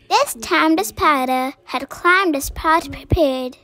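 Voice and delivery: ordinary delivery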